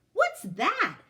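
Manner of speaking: lightly voiced curious girl; curious Madison. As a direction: ask like a nosy child. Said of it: "Madison said".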